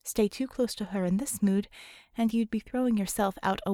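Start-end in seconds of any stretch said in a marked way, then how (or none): none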